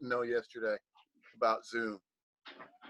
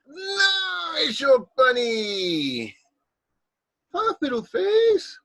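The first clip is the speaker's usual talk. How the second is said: high, drawn-out cutesy greeting; playful bunny voice; 11 semitones higher, 4 dB louder, much slower than usual. Pretend. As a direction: coo it very high and slow, stretching the vowels. Playful pet-name greeting to Rabbit.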